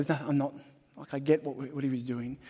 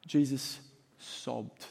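much softer, sobbed dropping away; hushed sombre; 3 semitones lower, 6 dB quieter, much slower than usual. Acted. Preacher stressing Jesus' grief, hushed and heavy. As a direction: nearly breathe the word sobbed. Solemn restating of the verse.

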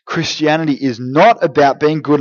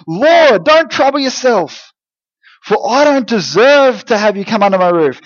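acted plea, raised in pitch; voiced humble appeal; 7 semitones higher, 4 dB louder than usual. Centurion's friends beg Jesus not to trouble himself, acted appeal. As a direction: read the messenger's words high and emphatic.

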